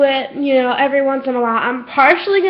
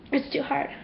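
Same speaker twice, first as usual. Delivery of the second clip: much softer, the words almost fading away; faded near-whisper; 14 dB quieter than usual. Real, not acted. Repeating to herself how hard it is, fading away. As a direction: let the repeat die out into barely a breath. Repeats line, second fades.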